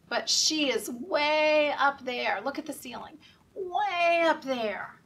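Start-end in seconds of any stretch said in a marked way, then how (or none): 2.4-3.2 put-on whispered aside, pointing the kids upward. Reader whispering to children to look up. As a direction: hush it like a secret.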